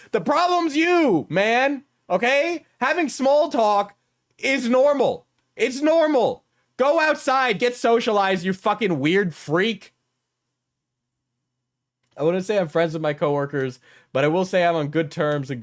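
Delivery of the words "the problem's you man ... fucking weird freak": higher, pushing hard, lecturing; worked-up rant. Real, not acted. Streamer scolding people who hate small talk, worked-up rant. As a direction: scold them like it's obvious. Heated rant at antisocial coworkers.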